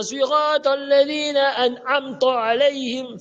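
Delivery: high chant with AN'AMTA ending in heavy TO; wrong TO recitation. Retold or imitated. Teacher imitating how people recite, high chant with wrong to. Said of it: "Faux. Ce n'est pas to".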